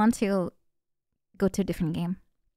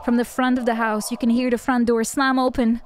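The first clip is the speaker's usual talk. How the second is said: usual speaking voice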